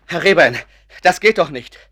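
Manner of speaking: loud, urgent call across the room. Acted. Doctor calls out to stop Rehbein burning his paintings, loud and urgent. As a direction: shout to stop him.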